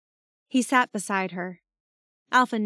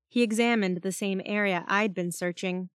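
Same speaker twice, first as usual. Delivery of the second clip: plain reading voice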